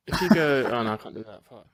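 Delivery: usual speaking voice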